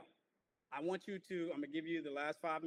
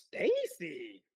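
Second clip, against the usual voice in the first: drawn-out loud call of the name; stretched, raised call to a student; 6 dB louder than usual. Real, not acted. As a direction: call Stacy out, slow and loud. Teacher calls student's name.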